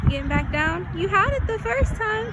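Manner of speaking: high, loud cheering parent voice; encouraging mom. Real, not acted. Mom coaching daughter climbing at park.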